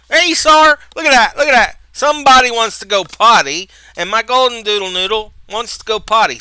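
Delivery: hey Sar, called out loud and high; calling to the dog. Real, not acted. Man calling out to his golden doodle, loud and high.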